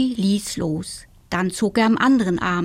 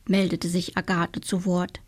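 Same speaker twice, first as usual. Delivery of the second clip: ordinary delivery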